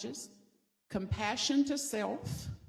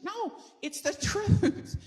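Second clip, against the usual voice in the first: laughing insistence; 11 semitones higher, 5 dB louder, slower than usual. Retold or imitated.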